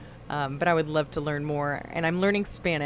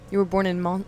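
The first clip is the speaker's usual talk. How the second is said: ordinary talk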